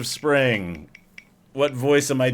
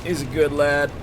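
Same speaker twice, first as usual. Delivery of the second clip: ordinary talk, nothing marked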